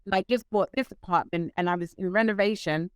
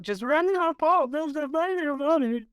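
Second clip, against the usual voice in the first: mock despair; 7 semitones higher than usual.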